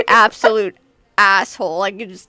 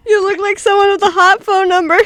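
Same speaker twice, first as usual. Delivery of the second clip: laughing pickup line; 11 semitones higher, 7 dB louder than usual. Pretend. Pickup line, higher, louder, laughing.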